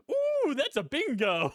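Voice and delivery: high, slow, delighted mock German officer with a laugh; gleeful imitation of a smug villain; Christoph Waltz. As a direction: stretch out the ooh way up, then savour the bingo. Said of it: "when Christoph Waltz is like".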